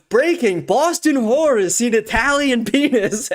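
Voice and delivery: imitated mock news announcement, slow; lightly hammed-up headline delivery; breaking-news parody. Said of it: "his delivery on that".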